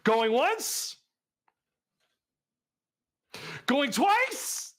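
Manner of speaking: rising, drawn-out countdown call; auctioneer's call. Pretend.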